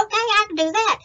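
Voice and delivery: high, loud crowing rooster voice; piercing, high-pitched bird voice; rooster character. Acted. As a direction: crow the answer out as a rooster. Acts out the rooster replying.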